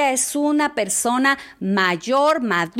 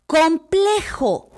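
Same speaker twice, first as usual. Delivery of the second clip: complejo drawn out slowly, every syllable weighted; stressed key word; 4 semitones higher, much slower than usual. Shown as a demonstration. Teacher spelling out the key word to listeners, slow and stressed. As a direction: land hard on complejo, slowly. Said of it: "te voy a decir una palabrita".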